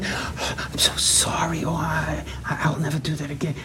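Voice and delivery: hushed, whispery contrition; meek apology. Retold or imitated. Apologizing to the screaming girl, meek. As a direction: whisper it like a cowed penitent. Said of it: "You, you sit there like".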